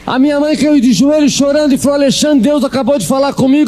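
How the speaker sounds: high, fervent preacher build; preaching at full force. Acted. Preacher tells the congregation of the phone call, preaching at full force. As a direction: drive the climax home. Climax continues.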